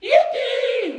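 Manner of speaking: sudden explosion of joy, high and loud; jubilant yell. A narrator's reading. Grandpa Joe explodes with joy at the ticket, yelling. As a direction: whoop it out at the top of your voice. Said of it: "he shouted".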